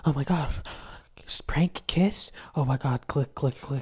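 mock excited kid, whispering prank kiss. Mocking little kids clicking YouTube pranks. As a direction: gasp like a hooked little kid. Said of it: "Little ass kids be on YouTube like".

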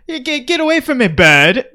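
high, fast fake British, loud on the last word; bad British accent. Podcaster riffing on a British killer, badly faked British accent. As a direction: squawk it like a badly faked Brit. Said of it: "the worst British accent I've ever heard".

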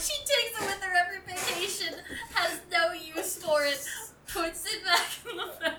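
squeaky laugh-through words; giggling through the whole line. Real, not acted. Laughing while describing item.

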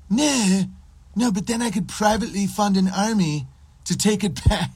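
high, protesting mock voice; mocked libertarian. Libertarian objecting to having his stuff taken, mocked high voice. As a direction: whine out the objection in a put-on high voice.

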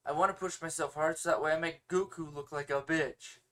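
ordinary talk

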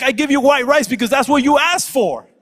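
high, loud mock outburst; exasperated dad. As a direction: yell back in hammed-up exasperation. Exasperated dad answers complaining son.

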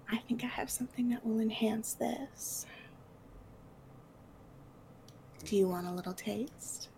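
soft, half-whispered offer; a hint of hushed seduction; lightly voiced temptress. Temptress offers a little bottle to her partners, softly seductive.

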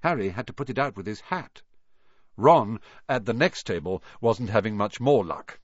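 plain reading voice

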